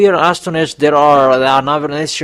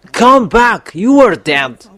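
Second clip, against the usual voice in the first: higher repeated call; 6 semitones higher, slower than usual. Acted. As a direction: push the call higher the second time.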